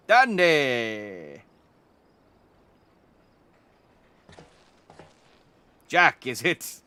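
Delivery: hammed-up game character mishearing a name; mock mishearing. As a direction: boom out the misheard name like a cheerful NPC. NPC mishears the player's name.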